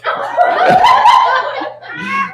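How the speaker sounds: shrieking high laugh; high squealing laugh. Real, not acted. Audience member nearly spits.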